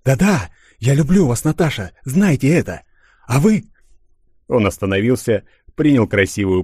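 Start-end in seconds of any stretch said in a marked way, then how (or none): 0.0-3.6 passionate. Acted. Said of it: "страстно заговорил".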